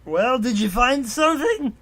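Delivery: hammed-up impatient Shogred. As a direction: demand it as the gruff Shogred. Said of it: "Shogred asked".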